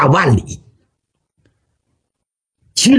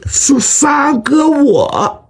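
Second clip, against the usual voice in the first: drawn-out reveal; 8 semitones higher, much slower than usual. Acted. Xu Liang reveals himself teasingly.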